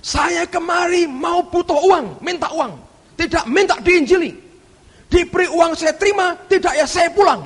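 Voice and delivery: angry retort. Retold or imitated. Con man rejects the Bible talk, angry retort. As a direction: snap back like an offended con man. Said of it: "Dia bentak".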